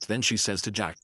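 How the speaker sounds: ordinary delivery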